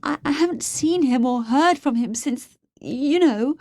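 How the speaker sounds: high, halting, anxious voice; stammering, distressed. A narrator's reading. Captive woman answers the man threatening her, stammering distress. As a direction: falter and trail into an awkward hint.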